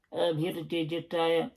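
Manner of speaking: put-on a light Buckie accent for the AA guy; lightly voiced Buckie mechanic; mild north-east Scottish accent; Bucky accent. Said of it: "giving him that accent".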